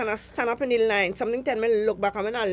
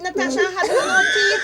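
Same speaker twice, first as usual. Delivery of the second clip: higher and louder, laughing through it; laughing shout; 9 semitones higher, 3 dB louder than usual. Real, not acted. To Natasha, repeating it in a laughing shout. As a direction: burst out laughing as she repeats it.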